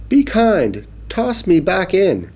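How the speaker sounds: small high pleading fish. Acted. Fish begging the boy to toss it back, high and pleading. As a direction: plead like a tiny fish in a pail.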